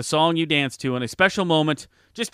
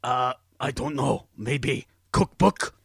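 gruff, dopey cookie-loving monster. Acted. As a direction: growl it like a hungry puppet monster. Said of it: "His name is Cookie Monster".

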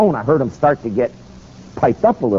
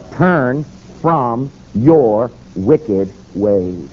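slow scripture quote; 3 semitones lower, much slower than usual. Acted. Preacher quoting scripture.

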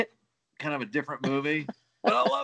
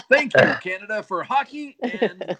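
mock grateful tribute; 5 semitones higher, 7 dB louder than usual.

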